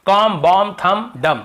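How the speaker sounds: "कॉम, बॉम, थम, डम" ending cleanly on m; silent B. Shown as a demonstration. Teacher recaps the rule for students, each word ending on m. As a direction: list "कॉम, बॉम, थम, डम" with no b at all. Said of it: "एम के बाद बी का उच्चारण नहीं करते".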